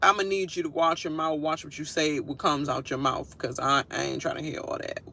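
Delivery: usual speaking voice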